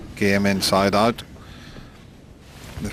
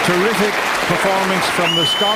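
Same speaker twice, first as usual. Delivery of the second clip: raised, higher call of the moment; excited commentary; 8 semitones higher, 4 dB louder than usual. Real, not acted. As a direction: lift it for the big moment. Celebrating century, excited call.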